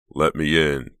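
loud call at normal pitch; urgent cry. A narrator's reading. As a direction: call out to be let in. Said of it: "Chuck cried".

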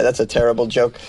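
normal talking voice

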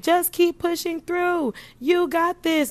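high, pushy gym coach calls, slowed down; mock trainer egging you on through the last seconds; imitating a yelling instructor. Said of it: "the instructor is just yelling at you".

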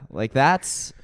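ordinary talk, nothing marked

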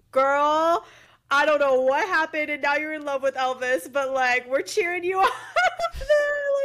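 a lightly voiced grandma cheering you on, laughing. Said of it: "I know she's looking at me in heaven, like".

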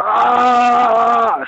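high, loud shriek of pain; loud scream. Retold or imitated. Paul jabbed with a pencil, high loud shriek. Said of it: "Screaming so loud".

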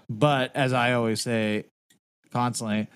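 normal talking voice

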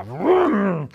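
loud revving vroom; engine vroom. Acted. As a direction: imitate the car engine. Car starting noise. (rugido de motor)

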